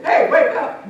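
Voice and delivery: sharp, high, very loud yell; wake-up shout. Acted. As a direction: shout to jolt a sleeper awake. Example of rudely waking the lion.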